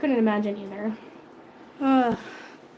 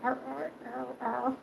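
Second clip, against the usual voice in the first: soft mock growling, barely voiced; cat growl; 11 dB quieter than usual. Owner imitating her cat growling at whisker counting. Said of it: "she's like".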